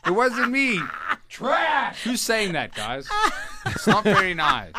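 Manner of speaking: high, laughing mock innocence. Real, not acted. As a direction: giggle through the denial.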